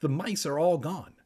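plain reading voice

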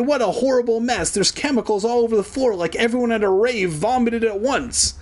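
high, hurried exclamation; dismayed cry. A narrator's reading. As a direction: cry out high, rattling off the disaster. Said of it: "Evan cried".

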